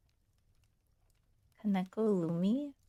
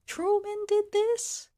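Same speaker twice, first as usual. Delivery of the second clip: shocked; 11 semitones higher, 5 dB louder, slower than usual. Real, not acted. Shocked at history fact.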